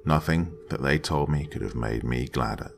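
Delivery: ordinary delivery, nothing marked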